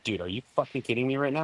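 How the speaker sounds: quick, hushed mock incredulity; softly aghast, said fast; retold disbelief. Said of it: "G looks at me and he's like".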